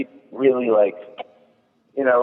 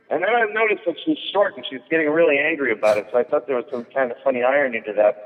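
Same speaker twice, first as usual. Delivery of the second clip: ordinary talk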